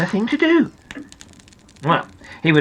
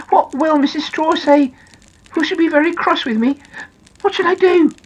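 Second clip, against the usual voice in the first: high-pitched, worried little character; alarmed; 11 semitones higher, 3 dB louder than usual. Acted. Noddy worries about Missus Straw, high and alarmed. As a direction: fret in a high storybook voice. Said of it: "said Noddy in alarm".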